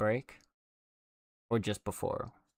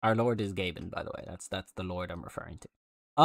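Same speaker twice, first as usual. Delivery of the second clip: ordinary delivery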